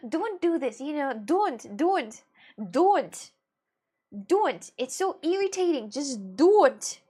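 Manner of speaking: exasperated pleading to stop; emphatic don'ts. Real, not acted. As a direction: scold firmly, word by word. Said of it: "fucking don't do this".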